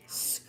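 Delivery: drawn-out "skrrr" squeak; imitated skid noise. Showing the slide down the windshield, imitated skid noise. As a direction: imitate skin skidding down glass. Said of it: "It was literally like".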